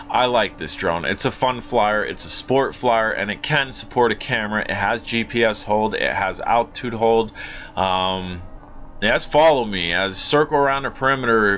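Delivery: normal talking voice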